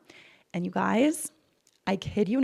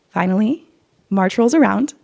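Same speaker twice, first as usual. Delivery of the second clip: normal talking voice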